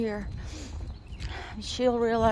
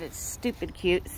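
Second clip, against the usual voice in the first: normal talking voice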